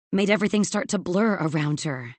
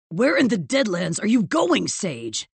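calling after her; 3 semitones higher, 4 dB louder than usual. A narrator's reading. Boss to Evie as she storms past, calling after her. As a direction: call out sharply after someone leaving. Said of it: "her boss called after her".

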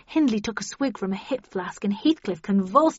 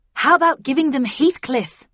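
loud, raised group suggestion; chorus calling out; 6 semitones higher, 8 dB louder than usual. A narrator's reading. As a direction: chime in eagerly as a crowd. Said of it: "came a chorus of voices".

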